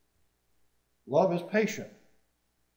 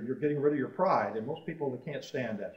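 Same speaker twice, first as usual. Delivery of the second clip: usual speaking voice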